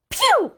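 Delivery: loud, high cry of disgust; disgusted pew. Acted. Reader reacting to the smelly stew, loud disgusted pew. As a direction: recoil from the stink.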